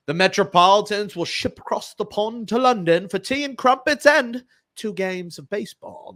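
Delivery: a deliberately bad mock British accent; hammed-up British accent for the London trip. Host joking about the Mets' London trip, in a bad mock British accent. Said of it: "That was a terrible accent".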